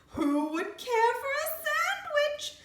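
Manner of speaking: high, slow sing-song call. A narrator's reading. Creature calling closer to the piglet. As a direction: lure him in with a drawn-out call. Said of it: "closer and louder this time".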